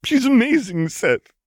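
acted crying, pitch shooting up; sobbing. A narrator's reading. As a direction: wail it through sobs. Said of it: "Lux sobbed".

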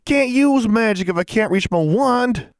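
high, loud angry protest; furious. A narrator's reading. Bound boy snapping at girl, furious. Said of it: "He glared furiously at her".

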